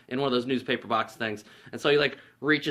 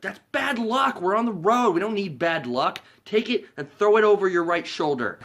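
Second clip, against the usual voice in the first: higher, louder, earnest warning; superstitious Lloyd; 7 semitones higher, 4 dB louder than usual. Retold or imitated. Lloyd warning Harry over spilled salt, earnest nervous voice. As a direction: warn him like it matters.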